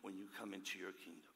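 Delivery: soft, hushed plea to Jesus; humble plea. Acted. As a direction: ask gently, barely above a breath. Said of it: "and he says".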